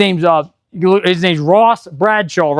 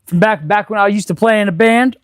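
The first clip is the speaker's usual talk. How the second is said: ordinary talk, nothing marked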